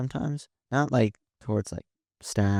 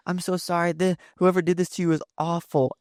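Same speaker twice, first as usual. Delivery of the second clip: high, gushing mock sympathy; mocking commenters; 8 semitones higher than usual. Mocking commenters consoling his ex. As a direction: play the sugary comment section. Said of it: "people in the comments were like".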